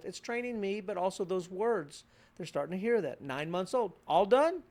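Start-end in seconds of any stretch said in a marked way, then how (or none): 4.1-4.6 put-on baby cooing. Parent cooing to nine-month-old.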